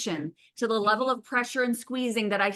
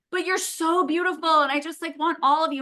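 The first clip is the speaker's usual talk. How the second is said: pleading groom; 7 semitones higher than usual. Retold or imitated. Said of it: "And he's like".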